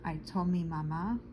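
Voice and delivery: ordinary delivery, nothing marked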